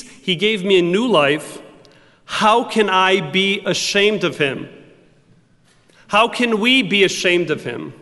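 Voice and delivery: a rising, earnest preacher's build; fervent preaching. Acted. Preacher testifying to the congregation, building fervently. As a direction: pour your testimony out to the congregation.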